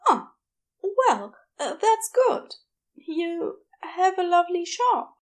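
high, shy, fumbling compliment; flustered Aziraphale. A narrator's reading. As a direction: cover awkwardness with brightness.

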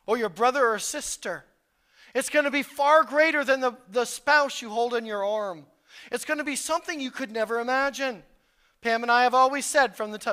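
loud, rising sermon voice pressing the point; full-force preaching. Acted. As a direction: preach it hard to the congregation.

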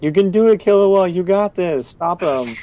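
high, loud cheer from the sidelines, imitated; egging on. Friend cheering Killua on against his brother, high-pitched mock egging-on. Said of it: "egging him on".

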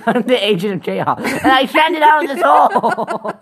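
high, loud laughing declaration; laughing shout. Real, not acted. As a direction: proclaim it through laughter. Agent of chaos, laughing.

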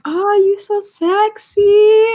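high, slow, sing-song auntie voice; mock Filipino auntie. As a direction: coo it like an auntie. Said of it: "Filipino women be like".

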